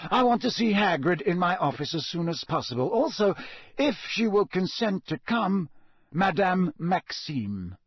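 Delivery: a lightly voiced calm old headmaster; gentle authority of an old wizard; Dumbledore voice. A narrator's reading. Dumbledore instructs McGonagall.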